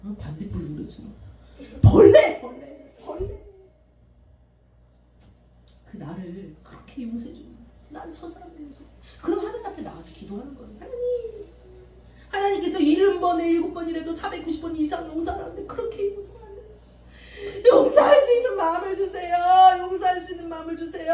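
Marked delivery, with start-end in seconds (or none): 1.8-3.4 mock self-scorn. Self-mocking bug. 10.8-21.1 higher, crying plea rising to a shout; tearful prayer. Acted. Pastor praying for a forgiving heart, crying out. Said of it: "라고 막 어떤 목사님이 기도를 했더니".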